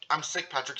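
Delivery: ordinary delivery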